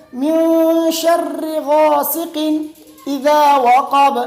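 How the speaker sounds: "شر" ending in "-ri", slowly chanted; correct short i. Shown as a demonstration. Teacher corrects the vowel, slow tajweed with short i. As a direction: draw out "من شر غاسق" with a clear i in "sharri". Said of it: "یہ شر نہیں ہے بلکہ".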